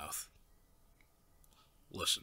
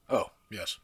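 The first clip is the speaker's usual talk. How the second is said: normal talking voice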